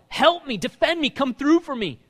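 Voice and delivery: loud, high, desperate plea; crying out to God. Acted. David crying out to God for defense, loud. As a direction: beg for help at full voice. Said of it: "David is crying out to God".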